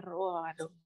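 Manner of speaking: ordinary talk, nothing marked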